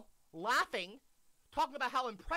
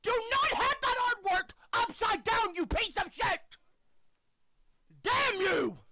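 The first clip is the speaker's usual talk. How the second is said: furious shout; 14 semitones higher, 8 dB louder, slower than usual. Real, not acted. Streamer yelling at video creator.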